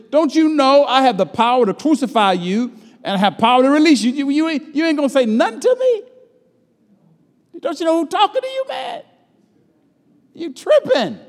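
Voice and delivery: high-pitched, indignant Pilate voice; mocking Pilate. Preacher voicing Pilate demanding that Jesus answer, high and indignant. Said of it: "And he goes on to say".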